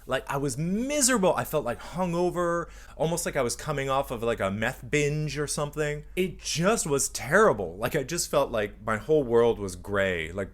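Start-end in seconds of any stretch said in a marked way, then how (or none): none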